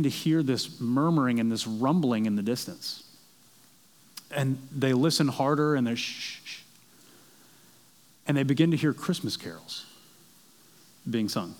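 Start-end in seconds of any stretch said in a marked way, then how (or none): 6.0-6.6 soft, drawn-out "shh"; imitated shushing. British soldiers hushing each other to listen in the trenches, imitated shushing. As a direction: hush them as if straining to hear.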